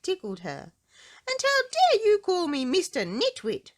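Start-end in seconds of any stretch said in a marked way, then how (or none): 1.3-3.7 very high, loud outraged cry; indignant cry. Acted. Mister Bump confronting Miss Trouble, a high outraged cry. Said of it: "cried Mister Bump".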